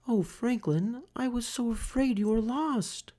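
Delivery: high, soft worried mum; relieved mother. A narrator's reading. Mother finds Franklin, high and relieved. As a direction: sigh it out in relief.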